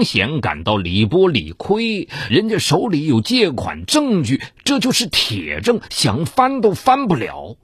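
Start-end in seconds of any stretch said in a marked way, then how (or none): none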